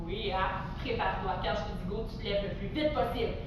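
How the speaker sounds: low, soft build-up of suspense; hushed readying. Pretend. Teacher readying children to jump up, hushed. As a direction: hold it down before the signal.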